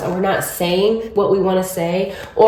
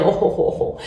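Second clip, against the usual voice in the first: playful ho ho laugh; 3 dB quieter than usual. Real, not acted. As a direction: chuckle the ho ho ho lightly. Laughs after time joke.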